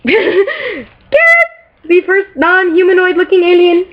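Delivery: high-pitched excited squeal; gushing fangirl. Real, not acted. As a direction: squeal it over your favourite character. Gushes over her favorite alien.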